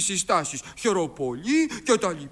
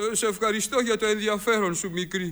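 rapid patter; much faster than usual. Acted. Twin answers in a rush.

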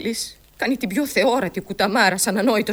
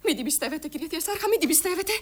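softer, higher urgent plea; confidential appeal; 4 semitones higher, 6 dB quieter, faster than usual. Acted. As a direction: urge him privately not to believe. (χαμηλόφωνη, επίμονη έκκληση)

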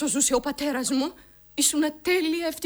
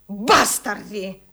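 slow, louder, spat out word; bitter; 4 semitones lower, 5 dB louder, much slower than usual. Acted. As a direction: drag out "Μπάσταρδοι" with scorn. Spitting the insult. (πικρά, αργά και δυνατά)

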